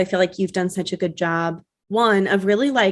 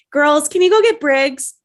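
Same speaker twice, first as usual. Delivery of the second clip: higher, louder imitation of mom calling; mock calling out; 8 semitones higher, 6 dB louder than usual. Imitating mom interrupting her live sale to the girls, calling out. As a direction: call to the kids mid-pitch, imitating.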